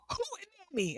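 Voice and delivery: high, soft sing-out swooping down; excited exclamation. Retold or imitated. Wife trying a strange word on her sleepy husband, high excited exclamation. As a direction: trill the word up high, imitating earlier excitement. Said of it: "I said it just like that".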